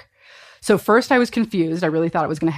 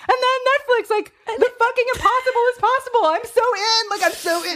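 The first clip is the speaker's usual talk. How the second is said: much higher, squealing mock excitement; excited Dallas. Imitating Dallas calling her about Netflix, high excited squeal. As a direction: gush like a thrilled friend on the phone. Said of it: "he was so excited".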